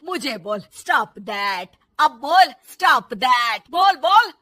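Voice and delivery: menacing taunt with stretched words; angry mother-in-law. Acted. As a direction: dare her, building to a shout. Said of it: "सास को फिर बहू पर गुस्सा आ जाता है".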